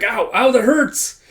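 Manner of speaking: high, loud, drawn-out ow; mock pained yelp. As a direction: yelp as if being slapped hard. Acted victim being slapped.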